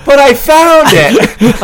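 high, loud, drawn-out protest; hammed-up excited yell; Paul's excited insistence. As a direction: imitate a friend insisting at full volume. Acts Paul insisting on his find.

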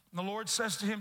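usual speaking voice

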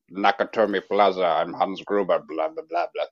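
mock German villain accent. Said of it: "that's a German accent".

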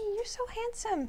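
high cooing pet voice; talking to a pet. Real, not acted. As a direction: coo at the bird. Cooing at a parrot.

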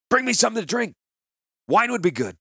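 loud, high shout of an order; calling out. Acted. Gerard shouts an order to the boy. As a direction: yell it across the market.

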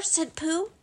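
ordinary delivery

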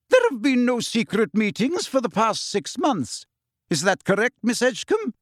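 stern Scottish headmistress; crisp, severe questioning; strict teacher voice. Acted. McGonagall voiced in audiobook.

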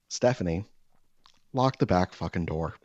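normal talking voice

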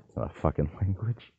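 laughing through the words; half-laughing in disbelief; cracking up while calling it out. Real, not acted. Mocking people who believe Pig Latin.

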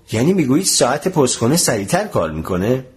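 ordinary delivery, nothing marked

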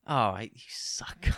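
breathy whispered scolding, half joking. Real, not acted. To friends who left him alone, whispered. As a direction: whisper a mock complaint at your friends.

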